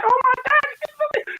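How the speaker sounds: very high, slow, stretched gasp of awe; imitating his own starstruck squeal. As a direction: let it squeak out in disbelief. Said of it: "I was like".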